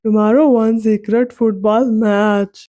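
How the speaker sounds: slow, careful English in a different voice; Ronaldo, gently. A narrator's reading. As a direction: lay it out slowly, stunned. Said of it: "Ronaldo मुस्कुराया और बोला".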